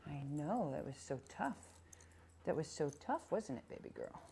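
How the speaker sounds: gentle pet comfort; soft consoling. Real, not acted. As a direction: soothe the dog after a hard moment. Trainer consoling dog after hard drill.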